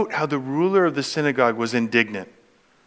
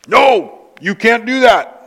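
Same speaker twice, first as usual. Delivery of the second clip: loud, higher mock protest; indignant ruler; 9 semitones higher, 10 dB louder than usual. Pastor voicing the ruler scolding Jesus, loud and indignant. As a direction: bark it as the outraged synagogue ruler.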